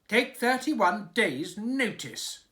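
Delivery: slow, high, loud furious shout. A narrator's reading. King shouting at his servants, furious and loud. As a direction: roar it with rage. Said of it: "shouted a furious voice".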